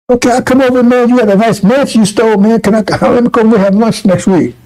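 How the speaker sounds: a crook inviting himself over to lunch; cocky criminal. Retold or imitated. Freed criminal chatting with judge.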